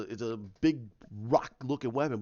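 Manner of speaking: ordinary delivery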